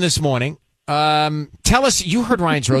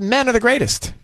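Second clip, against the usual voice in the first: retold sarcastic praise; imitating a caller's mock admiration; 7 semitones higher than usual.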